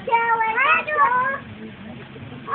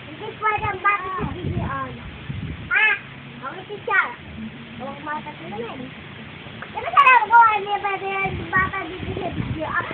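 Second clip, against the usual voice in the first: ordinary talk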